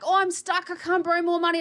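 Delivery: high, loud whining stuck client; mock whiny client. Investor mocking a stuck client, high whiny voice. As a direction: whine like a client drowning in debt.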